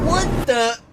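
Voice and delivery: high, loud startled yell. Acted. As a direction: yelp it in shock. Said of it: "he yelled".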